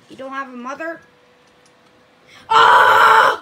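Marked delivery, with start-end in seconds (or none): 2.5-3.4 loud burning reaction; roast shout. Real, not acted. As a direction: yell Oh like a crowd.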